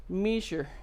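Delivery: "Measure" as "MEE-zhur", French Canadian, falling tone; French Canadian accent. Retold or imitated. Trying to remember his word, French Canadian accent on "Measure". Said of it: "How did he say measure?".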